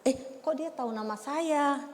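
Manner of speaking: small, lifted questioning voice; child's surprised reaction. Acted. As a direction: play a little pupil quietly amazed. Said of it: "Langsung si Kenjo".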